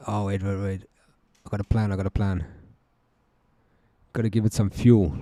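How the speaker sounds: ordinary talk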